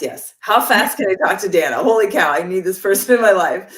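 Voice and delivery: loud, eager burst of enthusiasm; excited. Retold or imitated. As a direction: gush like you've found the one. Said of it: "for me, it was like all caps".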